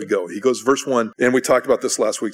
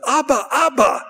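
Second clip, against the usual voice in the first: put-on much higher, louder boy's cry; high-pitched eager shout; child's excited yell; 5 dB louder than usual. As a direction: yell it like an excited little boy calling Daddy. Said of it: "he's yelling".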